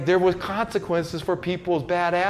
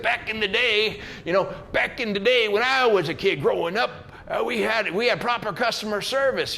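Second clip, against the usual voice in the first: put-on higher-pitched cranky old man grumbling; grumpy old guy; 5 semitones higher than usual. Old guy complaining about modern customer service, cranky. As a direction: play the fist-shaking old-timer griping about the good old days.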